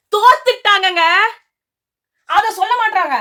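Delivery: high, indignant protest; indignant outburst. Real, not acted. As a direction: call it out, pitched well up. Indignant complaint about the show.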